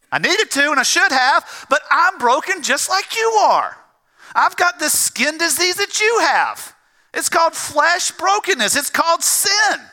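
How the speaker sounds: high, ringing pulpit confession; fervent preacher owning up to the congregation; impassioned preaching. Real, not acted. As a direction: preach it high and forceful, phrase by phrase. Preacher's confession at full force.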